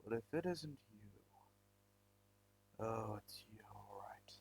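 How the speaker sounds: slow, fading into a whisper; sinister hush. Acted. Villain recognizing the hero through the portal, sinister whisper. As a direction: let it sink to a menacing whisper.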